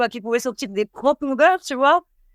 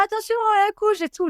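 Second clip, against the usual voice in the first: alarmed warning; 10 semitones higher than usual. Retold or imitated. Paramedics alarmed in ambulance.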